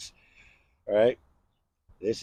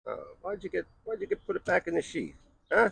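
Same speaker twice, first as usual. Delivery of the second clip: playful scolding; 9 semitones higher, faster than usual. Acted.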